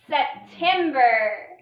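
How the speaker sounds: September, drawn out very slowly; slow blended reading. Shown as a demonstration. Teacher spelling aloud with the class, very slow. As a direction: stretch "September" so every part is heard. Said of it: "Our next syllable was".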